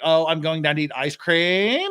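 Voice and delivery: statement turned question, rising on cream; Valley Girl uptalk, cream sliding up. Shown as a demonstration. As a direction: say it like asking, lifting ice cream at the end. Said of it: "everything was a question".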